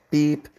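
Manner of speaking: a quick high vocal beep; whistle beep. Shown as a demonstration. Trainer replacing the word with the whistle, a high beep. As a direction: chirp it like a whistle. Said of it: "just hit the whistle".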